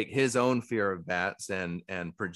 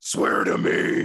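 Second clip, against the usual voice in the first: rasped unvoiced Batman growl; deep distorted monster roar; 9 dB louder, slower than usual. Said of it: "he talked in his monster voice".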